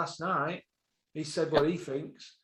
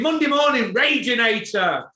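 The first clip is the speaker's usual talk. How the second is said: loud, high angry announcement; raging; 4 semitones higher, 5 dB louder than usual. Real, not acted. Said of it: "Listen, I'm fucking raging".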